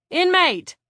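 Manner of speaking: high, forceful shout. A narrator's reading. Officer Van at a brawling inmate, shouting. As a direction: yell it as an officer's order. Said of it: "Van shouted".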